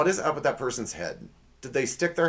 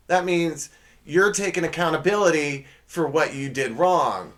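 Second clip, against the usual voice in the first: normal talking voice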